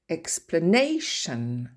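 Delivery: slow Explanation, stress on NA. Shown as a demonstration. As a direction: stretch and hit NA in Explanation. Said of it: "l'accent tonique se trouve sur la syllabe d'avant". (accent tonique sur NA dans Explanation)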